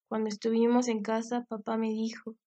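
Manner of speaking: plain reading voice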